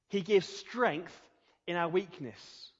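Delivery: slow, deliberate, each word weighed; a slow, emphatic preacher's line; slow emphasis. Real, not acted. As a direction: spell it out slowly for the congregation.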